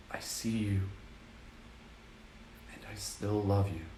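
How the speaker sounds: whisper-soft reassurance to a hurt part of you; tender apology. Shown as a demonstration. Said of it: "And just be like".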